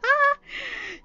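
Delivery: very high, loud, laughing shriek. Acted. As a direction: cackle it out in pure delight. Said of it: "in between bouts of laughing".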